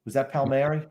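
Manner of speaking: ordinary talk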